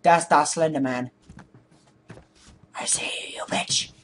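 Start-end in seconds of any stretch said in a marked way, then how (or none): 2.7-3.9 hushed unvoiced whisper. Real, not acted. Gamer whispering at Slender Man he spotted. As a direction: whisper it to the lurking monster.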